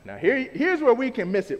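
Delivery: ordinary delivery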